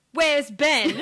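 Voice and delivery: hammed-up British mom calling out; imitated English accent. Impersonating the English mom looking for Ben, imitated English accent. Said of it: "I can't even do the accent".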